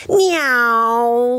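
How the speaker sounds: high, swooping imitated plane engine; aeroplane noise. A narrator's reading. Baby Bear playing aeroplane in bed, imitated engine whine. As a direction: imitate a plane zooming past. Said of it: "pretending to be an aeroplane".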